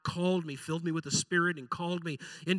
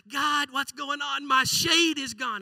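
high, slow, complaining whine; a sulking prophet griping to God; whiny Jonah; 7 semitones higher, 3 dB louder, slower than usual. Retold or imitated. Jonah whining to God that his shade is gone, high and slow. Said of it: "And he's like".

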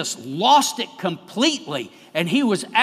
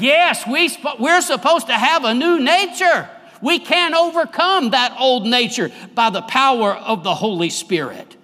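full-force preaching; 6 semitones higher, 5 dB louder than usual. Acted. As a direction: drive each phrase home from the pulpit.

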